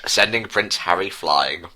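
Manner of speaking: usual speaking voice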